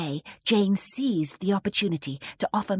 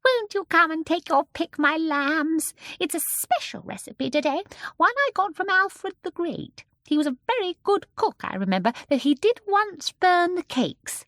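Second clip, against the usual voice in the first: put-on high, coaxing little old lady; sweet old shopkeeper; 11 semitones higher, 4 dB louder than usual. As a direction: invite the children in a sweet high voice. Mrs Corrie sweetly serves children.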